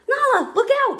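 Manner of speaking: high, loud warning; alarmed cry. A narrator's reading. Cub warning friend of rushing rhinos, crying out. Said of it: "Simba cried".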